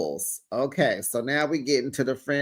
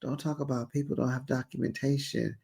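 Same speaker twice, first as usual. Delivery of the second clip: much softer, faster muttered repeat; softly mulling over the quote; quiet; 4 semitones lower, 10 dB quieter, faster than usual. Retold or imitated. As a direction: repeat it under your breath, quickly. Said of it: "who is she talking about?".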